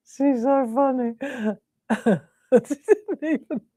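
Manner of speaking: high, helpless giggly talk; giggling between the words, pitched high; laughing. Real, not acted. Laughing at her own story.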